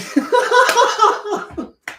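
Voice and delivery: high-pitched giggling burst; high giggle. Real, not acted. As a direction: giggle at yourself. Laughing at own accent.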